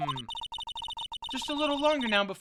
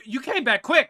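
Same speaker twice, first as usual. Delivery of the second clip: normal talking voice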